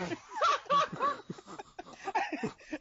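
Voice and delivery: high squealing laugh. Real, not acted. Laughing while telling.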